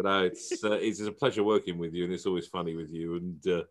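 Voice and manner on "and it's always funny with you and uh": ordinary talk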